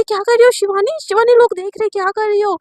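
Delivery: loud, high, alarmed protest; yelling at her friend to stop; shocked protest. A narrator's reading. Dragged out before staff, protesting. (ऊँची, घबराई हुई आवाज़ में चिल्लाकर विरोध)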